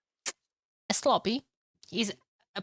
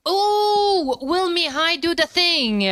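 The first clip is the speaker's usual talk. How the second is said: loud, high, stretched-out caster shout; excited hype; 9 semitones higher, 6 dB louder, slower than usual. Real, not acted. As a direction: build big suspense for the viewers. Hype over a player's move.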